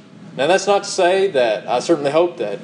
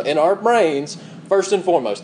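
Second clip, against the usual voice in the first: ordinary talk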